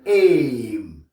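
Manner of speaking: "aim" as a long falling AY closing on M; English AI plus M. Shown as a demonstration. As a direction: draw out "aim", hum the final M. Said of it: "Avec M". (« aim » allongé, M final prononcé)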